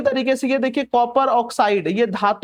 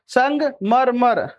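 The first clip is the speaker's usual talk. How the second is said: संगमरमर very slowly, split as संग, मर, मर; slow, broken-up word; much slower than usual. Shown as a demonstration. Teacher breaking down संगमरमर for students to remember, slow and segmented. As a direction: stretch संगमरमर out in separate parts.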